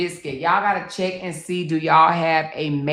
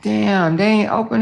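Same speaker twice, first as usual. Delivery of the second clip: normal talking voice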